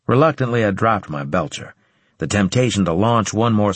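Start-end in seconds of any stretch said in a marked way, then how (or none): none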